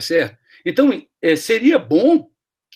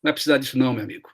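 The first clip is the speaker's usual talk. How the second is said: calm dismissal; 5 semitones lower, 5 dB quieter, faster than usual. Retold or imitated. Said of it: "me cumprimentou".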